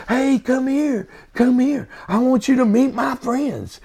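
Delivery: patient proudly calling his friends over; excited patient greeting. Retold or imitated. Patient's delighted greeting, voiced.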